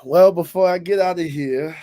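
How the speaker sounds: hollering revival preacher. Pretend. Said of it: "evangelist voice on".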